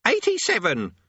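very high caught-out squeak. Acted. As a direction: squeak it out defensively. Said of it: "squeaked Thursley".

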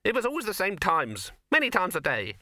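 high, squeaky little rabbit voice; a high, nervous talking rabbit. Acted. Rabbit continuing.

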